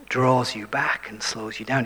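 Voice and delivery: normal talking voice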